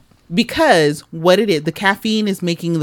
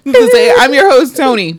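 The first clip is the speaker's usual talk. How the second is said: high loud host intro; talk show host; 8 semitones higher, 8 dB louder than usual. Acted. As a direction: announce yourself like a host.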